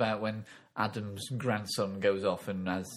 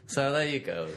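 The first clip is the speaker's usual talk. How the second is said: usual speaking voice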